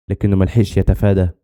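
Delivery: usual speaking voice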